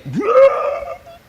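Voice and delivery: high, loud tearful outburst; real sob bursting out high and loud; crying. Real, not acted. Man overcome retelling God's words to him, a loud high sob. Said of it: "I'm crying like a baby".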